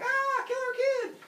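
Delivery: loud, high, drawn-out mock terror; comic shriek of panic; panicked scream. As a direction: scream it high as if fleeing for your life. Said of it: "running and screaming".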